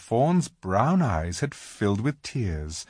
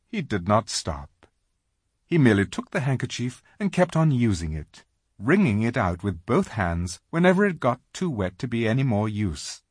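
ordinary delivery

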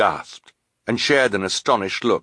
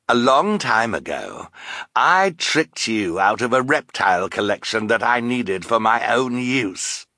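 gloating villain; 4 semitones higher than usual. Acted. Count Olaf to the netted Bruce, gloating. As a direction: crow over your victims.

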